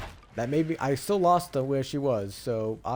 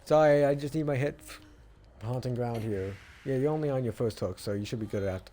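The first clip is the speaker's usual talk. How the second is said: ordinary delivery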